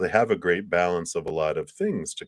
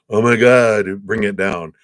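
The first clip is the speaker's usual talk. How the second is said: mock overdramatic gasp; 8 dB louder than usual. Mock overwhelmed emotional outburst.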